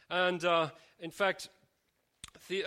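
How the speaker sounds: normal talking voice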